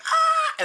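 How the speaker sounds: high mock movie scream; hammed-up high yell; imitated scream. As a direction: shriek like an action hero. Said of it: "he's like".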